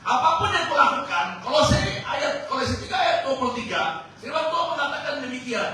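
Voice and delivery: high, loud, fervent preaching with laughs; full-force preaching. Acted. Preacher proclaims Colossians to the congregation fervently. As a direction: proclaim it to the whole church.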